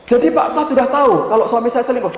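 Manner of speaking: high-pitched accusing question; imitated indignant disbelief. Wife confronting counsellor who already knew, imitated indignant disbelief.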